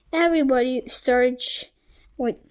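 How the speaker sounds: plain reading voice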